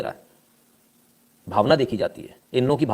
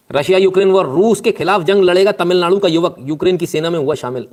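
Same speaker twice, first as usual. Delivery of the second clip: headline read; 6 semitones higher, 8 dB louder than usual. Acted. Reads out a news headline.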